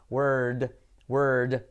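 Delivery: "word" held long with a voiced final D; correct lengthened word. Shown as a demonstration. Teacher models what was hard to say, long word. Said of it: "realmente es word".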